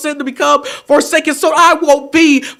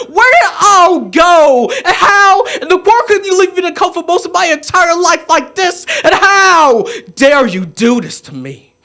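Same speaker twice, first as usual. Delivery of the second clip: performed scream of betrayal; anguished outcry; 3 semitones higher than usual. Acted. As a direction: cry out an accusation, falling off at the end. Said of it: "screaming out".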